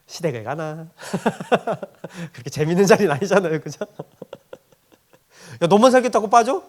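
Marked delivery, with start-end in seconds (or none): 0.9-2.3 short real chuckle; amused laugh at your own remark. Real, not acted. Laughs after own joke. 5.6-6.6 loud, higher mock-scolding protest; raised voice, comic indignation; indignant retort. Repeats retort to son for laughs.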